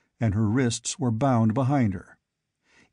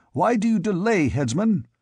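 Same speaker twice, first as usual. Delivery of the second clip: higher and sharper, demanding; angry exclamation; 7 semitones higher than usual. A narrator's reading. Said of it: "he exclaimed angrily".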